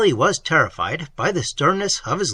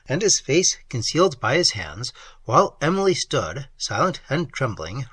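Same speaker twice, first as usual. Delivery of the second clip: plain reading voice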